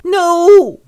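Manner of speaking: loud, high wailed no; horrified cry. Acted. Orphan told he'll be sold, wailing loudly. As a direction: cry out in melodramatic protest. Oliver cries out in protest.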